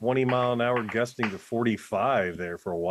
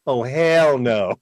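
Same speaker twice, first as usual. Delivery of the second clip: slow, louder disgusted refusal; appalled; 4 semitones higher, 7 dB louder, much slower than usual. Retold or imitated. Imitating listeners' reaction, slow louder disgust. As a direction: drag out the disgust. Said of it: "listen to this and go".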